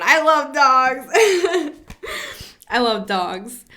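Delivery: breaks into a high laugh; real laughter about dogs. Real, not acted. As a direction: laugh through the words, then burst out laughing.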